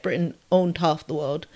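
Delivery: usual speaking voice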